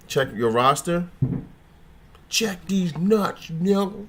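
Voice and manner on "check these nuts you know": mock taunt. Joking taunt with laugh.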